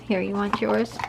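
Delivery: normal talking voice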